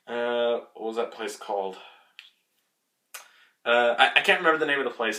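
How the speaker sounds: ordinary talk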